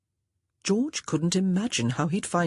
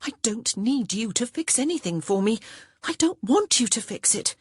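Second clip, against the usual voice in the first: higher, firm, pressing on want; insistent young woman; 7 semitones higher than usual. Acted. Honor refuses George's meddling, insistent. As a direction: refuse him, rising insistence.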